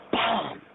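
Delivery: sudden high "boom!"; boom sound effect. Retold or imitated. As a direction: blurt the boom like an explosion. Sudden flash from the sky.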